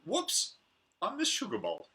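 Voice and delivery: sudden high comic whoops; high mock surprise; playful whoops. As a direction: catch yourself in a silly mistake. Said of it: "It amuses me".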